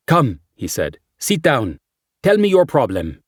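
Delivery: put-on brisk friendly Spanish accent. A narrator's reading.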